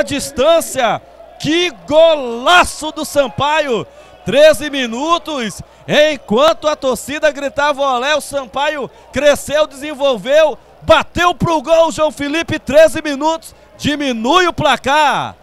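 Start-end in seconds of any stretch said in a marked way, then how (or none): none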